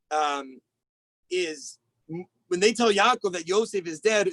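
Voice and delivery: normal talking voice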